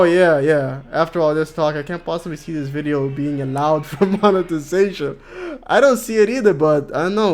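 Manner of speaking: normal talking voice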